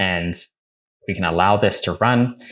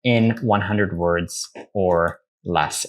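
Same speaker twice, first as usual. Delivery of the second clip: slow dictation; slower than usual. Shown as a demonstration. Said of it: "I'm gonna say to chat G P T".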